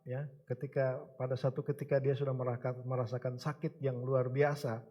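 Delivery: ordinary talk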